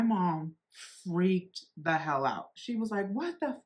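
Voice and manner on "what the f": mom losing it. Retold or imitated. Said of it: "like, she lost it".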